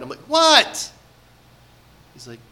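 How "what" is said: loud, high exasperated snap "What?"; annoyed. Retold or imitated. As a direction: bark it like an irritated dad cut off mid-lesson. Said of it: "and I'm annoyed".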